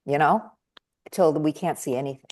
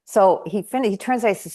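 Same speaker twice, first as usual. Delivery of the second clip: usual speaking voice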